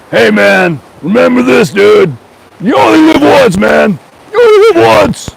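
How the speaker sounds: high, loud buddy shout; hammed-up neighbor yelling his motto; John's way of talking. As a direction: bellow the motto like the pushy neighbor. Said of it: "That's how he talked".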